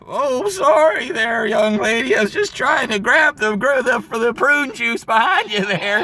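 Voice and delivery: put-on a polite old guy caught out; old man apologising.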